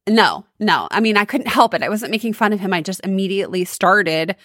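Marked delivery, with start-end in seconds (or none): none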